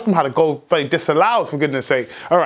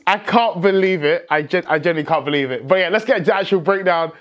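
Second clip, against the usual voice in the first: ordinary delivery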